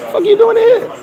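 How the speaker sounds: very high incredulous squeal; retold stunned woman; imitated woman's shock.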